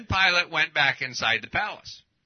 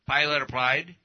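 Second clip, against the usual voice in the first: ordinary delivery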